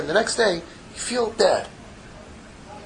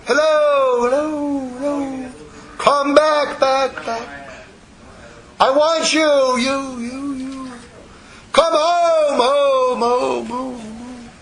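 high, drawn-out call with echoes fading away; heavenly voice; 7 semitones higher, much slower than usual. Acted. Heaven calling wandering children home, echoing heavenly voice. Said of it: "a voice from heaven, comes out and it says".

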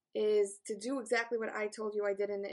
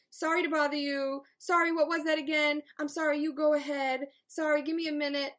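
higher, louder, hammed-up sorry reel; mock over-apologetic; 5 semitones higher, 6 dB louder than usual. Teacher mocking everyday sorries to her class, lightly higher. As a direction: parody a habitual apologizer, a bit higher. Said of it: "we're no longer saying the".

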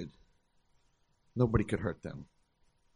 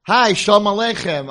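cheery greeting; 9 semitones higher, 13 dB louder than usual. Retold or imitated. Former student greets principal.

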